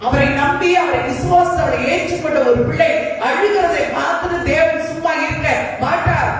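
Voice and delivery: high-pitched, ringing sermon declaration; impassioned preaching. Real, not acted. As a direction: proclaim it to the congregation, voice pitched high. Preacher builds in fervour.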